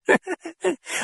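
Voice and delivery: high amused cackle. A narrator's reading. The voice cackling at the priest. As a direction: cackle with glee. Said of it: "cackle of amusement".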